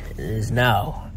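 normal talking voice

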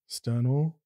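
flat, even monotone call. Acted. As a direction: say it with no tune at all. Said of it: "monotone androgynous voice".

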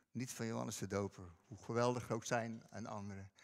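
ordinary talk, nothing marked

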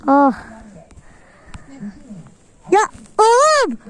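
ordinary delivery

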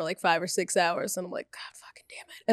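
unvoiced whispered curse. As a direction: breathe it out, barely voiced. Whispering about long airport wait.

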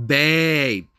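loud, high, drawn-out BABE; mocking pet name. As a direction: blare BABE in disbelief.

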